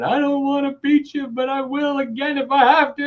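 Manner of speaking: imitating a tearful mother in a high voice; exaggerated high-pitched weepy threat; mock crying mom. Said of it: "she's crying".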